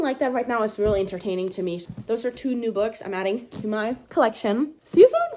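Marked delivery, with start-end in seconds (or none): 4.8-5.3 high, loud sing-song farewell; chirpy goodbye. Real, not acted. Signing off to viewers, high and chirpy.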